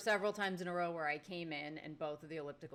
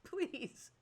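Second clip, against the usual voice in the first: exasperated disbelief; 10 semitones higher, slower than usual. Retold or imitated. Exasperated reaction to loud gym-goer.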